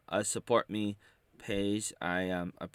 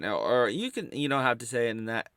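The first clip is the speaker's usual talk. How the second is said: normal talking voice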